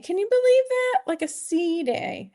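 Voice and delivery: surprised. Real, not acted. Disbelief at a sea day.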